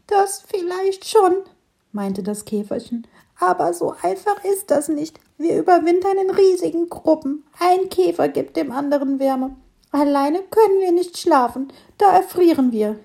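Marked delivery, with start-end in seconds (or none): 0.1-1.3 ladybird. Acted. Ladybird doubtful. 3.4-13.0 ladybird. Acted. Ladybird explaining.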